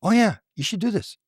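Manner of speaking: high, light coaxing voice; a lightly voiced urging being; coaxing alien voice. The being coaxes her to write, high and light. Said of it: "Da says to her".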